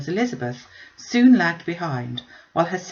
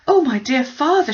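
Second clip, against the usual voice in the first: much higher and louder, pleading; urgent plea; 8 semitones higher, 7 dB louder than usual. A narrator's reading. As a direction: beg her father to hurry. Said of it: "she cried".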